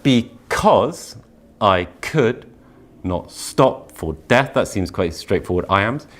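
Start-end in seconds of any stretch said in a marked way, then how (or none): 0.0-4.5 "not" lifted apart after a pause; slow, weighing each beat; stress on NOT. Shown as a demonstration. Teacher showing where Dickinson's emphasis falls. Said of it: "our emphasis does go slightly different".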